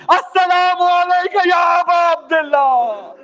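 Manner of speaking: slow, drawn-out cry of grief; tearful salutation. Acted. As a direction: call to the grave through tears. Jabir weeping calls at the grave. (گریہ کے ساتھ دھیمی پکار)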